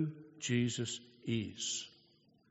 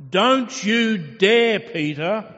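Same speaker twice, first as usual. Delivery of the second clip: slow, loud and much higher, leaning on every word; emphatic warning; 5 semitones higher, 10 dB louder, slower than usual. Acted. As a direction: warn him off with full weight. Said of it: "putting an emphasis on it".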